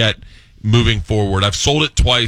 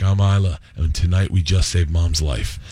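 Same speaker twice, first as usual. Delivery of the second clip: grave reveal; 6 semitones lower than usual. Acted. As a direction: play a daughter confiding a momentous secret. Daughter's grave reveal in retold dream.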